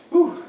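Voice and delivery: loud breathy exhale of relief; relieved whew. Real, not acted. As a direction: blow out hard after the effort. Exhale after a hard climb.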